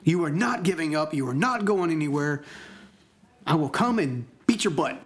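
emphatic scolding, starting high; acted-out tough-love yell; imitated yelling. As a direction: lay down the law, hitting each phrase hard. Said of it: "she's, like, yelling at me".

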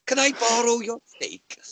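high-pitched comic pleading voice; pleading borrower. Pretend. Snake man asking a friend for a loan, pleading borrower.

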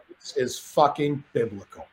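slow, soft, awestruck; awed. Retold or imitated. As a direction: breathe it out in awe. Awed on balcony over party.

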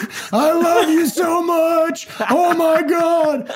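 high, slow, starstruck cry of adoration; gushing fan shout. Pretend. To Luke Skywalker, a starstruck fan shouting his adoration. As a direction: scream your love like a fan who met a hero.

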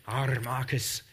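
lightly put-on Irish accent, low; a quick hint of Irish brogue. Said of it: "I shouldn't do an Irish, Irish accent".

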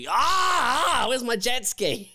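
high shouted mock panic over a lost jet ski; mock verbal-tic outburst. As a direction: blurt it out like a sudden tic. Imitating friend's verbal tics.

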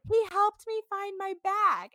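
a touch of small, sad and sweet; hint of a little girl's sad, sweet voice. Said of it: "little sad, sweet voice".

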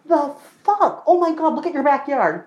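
high-pitched real amazement; overwhelmed, excited exclaiming. Real, not acted. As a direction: gush over a friend's place. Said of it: "overwhelmed Mile a Minute [name]".

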